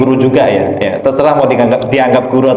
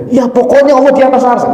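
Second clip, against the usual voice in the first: put-on stubborn old man; 10 semitones higher than usual. Old man's stubborn reply.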